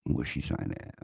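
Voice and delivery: whispered to himself while reading; soft breathy mutter, eyes on the screen; muttered aside. Real, not acted. Host reading the live chat, whispering to himself.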